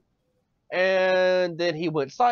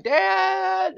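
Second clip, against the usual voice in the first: put-on kid calling dad. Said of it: "I'd be like".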